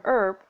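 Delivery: "herb" with no H, as "erb"; American "herb". Shown as a demonstration. Narrated example of dropping the H, American "herb". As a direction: say "herb" starting straight on the vowel. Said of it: "Americans might drop the H".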